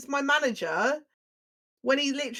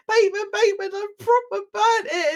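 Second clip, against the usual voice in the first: panicked; 8 semitones higher, 5 dB louder than usual. Retold or imitated. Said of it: "and I was like".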